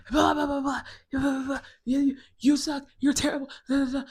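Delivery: high, loud mock squabbling; hammed-up angry clamour; imitated yelling. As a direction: imitate families yelling over each other. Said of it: "they're yelling".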